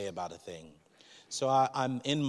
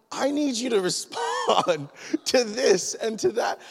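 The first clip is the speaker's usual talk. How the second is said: much higher, louder mock demand with a laugh; 13 semitones higher, 7 dB louder than usual. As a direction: insist at God, half laughing. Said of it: "And I'm like".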